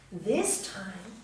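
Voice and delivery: plain reading voice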